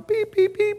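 high beeping tones; high radio beeps; imitated radio beeps. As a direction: mimic the dispatch alarm.

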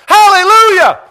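shrill high outburst, very loud; sudden hallelujah. Retold or imitated. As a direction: burst out in joy. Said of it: "timid little lady ... went".

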